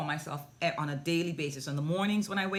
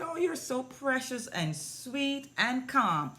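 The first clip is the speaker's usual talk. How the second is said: put-on higher, slow, doting baby talk; cooing praise; 7 semitones higher than usual. Praising the three-year-old as she pouts, slow high coo. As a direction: draw out the praise for a little one.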